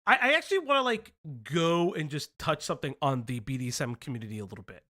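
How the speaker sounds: ordinary talk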